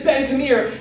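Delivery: loud urgent call. Retold or imitated. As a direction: call him over, loud. Brother calling out.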